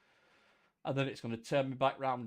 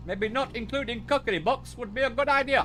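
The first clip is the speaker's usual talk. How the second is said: high, loud vintage announcer; old British broadcast; 8 semitones higher, 6 dB louder than usual. Acted. Player adding to the missionary bit, high loud British announcer. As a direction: add it in an antique radio voice. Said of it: "recordings of the British fucking overseas broadcasting service".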